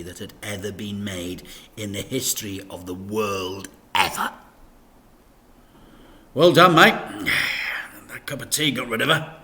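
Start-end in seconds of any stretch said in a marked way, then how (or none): 6.3-9.4 louder, cheeky congratulation; gleeful and conspiratorial; Dad, pleased. A narrator's reading. Dad praising Frank for the awful tea, cheeky.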